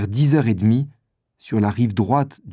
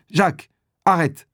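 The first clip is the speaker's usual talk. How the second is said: shout; 6 semitones higher than usual. A narrator's reading. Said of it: "crie Briand".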